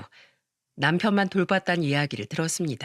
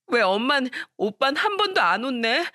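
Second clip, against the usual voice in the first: put-on high, tearful child whining to mom; crying complaint; 7 semitones higher than usual. Hurt daughter begging mom to scold her brother, crying. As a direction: plead through tears like a hurt young girl. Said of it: "엉엉 울면서 이야기를 했다고".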